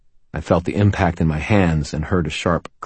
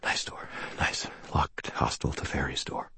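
whispered, out of breath from running; wheezing; 3 semitones lower, 13 dB quieter than usual. A narrator's reading. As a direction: pant it out in broken whispers. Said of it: "I wheezed".

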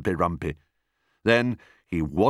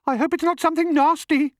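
very high, quick bird voice; singsong bird; 4 dB louder, faster than usual. A narrator's reading. Roly-poly bird hopes it's nothing nasty, high singsong. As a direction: trill it out fast and high. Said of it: "sang the roly-poly bird".

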